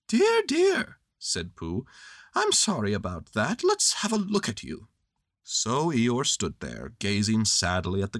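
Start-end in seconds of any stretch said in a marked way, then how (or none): 0.1-1.0 high, louder bear voice; bright little bear asking kindly; high cheerful Pooh Bear; cheery Pooh. A narrator's reading. Pooh's voice. 2.3-4.9 rising into high bear voice; a helpful little bear; lightly voiced Pooh; kindly Pooh. A narrator's reading. Pooh's voice.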